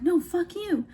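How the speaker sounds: high-pitched mock comeback; not calm boss. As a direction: imitate a riled boss, voice jumping up. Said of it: "instead of being, like, calm, cool".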